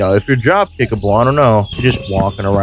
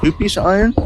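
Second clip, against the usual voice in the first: surprised delight; 10 semitones higher, faster than usual. Real, not acted. Delighted surprise at more iron.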